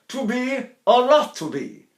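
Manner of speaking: high, slow declaimed line; hammed-up Hamlet. Shown as a demonstration. Teacher playfully declaiming Hamlet. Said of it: "So I go".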